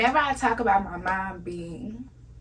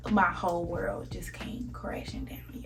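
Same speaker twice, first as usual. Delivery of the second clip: slow whisper, barely voiced; hushed whisper; 6 dB quieter than usual. Real, not acted. Recalling the moment her world fell apart, whispered. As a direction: breathe the words out, stunned. Moment she realized mom died.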